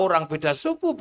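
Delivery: ordinary talk, nothing marked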